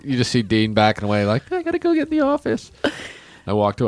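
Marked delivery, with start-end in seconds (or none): 1.5-2.7 high, jittery excuse to flee; nervous retreat. Retold or imitated. Manager Dean escaping to his office, nervous high voice. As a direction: squeak it while backing off. Manager backing away scared.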